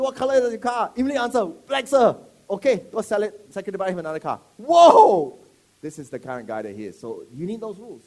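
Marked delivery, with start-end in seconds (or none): 0.0-4.4 loud, high, eager back-and-forth; eager exchange. Pretend. Acting out eager servant reply. 4.6-5.4 huge loud high exclamation; loud whoa. Pretend. Exclamation at the boss.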